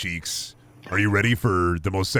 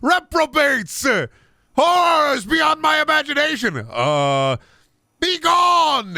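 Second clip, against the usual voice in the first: much higher, louder exorcist yell; hammed-up scream; 4 dB louder, slower than usual. Streamer hamming an RP hero banishing demons, shouting. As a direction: banish the demons at full volume. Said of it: "I scream".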